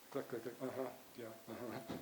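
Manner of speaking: low, soft, absent-minded acknowledgement; half-listening, absorbed in the phone; distracted texter. Wife texting in bed while husband talks, distracted mumbled replies. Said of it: "and she's texting".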